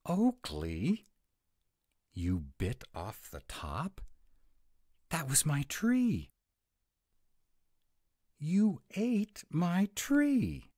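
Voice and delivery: rising, loud cry of outrage; shocked dismay. Acted. Friend wails that Oakley ate the cookie tree, outraged. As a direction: protest the eaten tree at full pitch.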